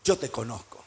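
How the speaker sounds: low, soft, knowing declaration; voice of God. Acted. God tells Moses He knows him, low quiet voice of God. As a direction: say it deep and quiet, with certainty. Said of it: "¿Qué le está diciendo Dios?".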